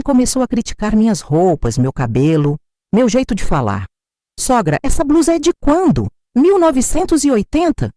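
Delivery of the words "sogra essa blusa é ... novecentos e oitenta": deboche agudo; zombaria. A narrator's reading. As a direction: ria da blusa com desdém. Nora zombando da roupa. (nora zombando da roupa da sogra)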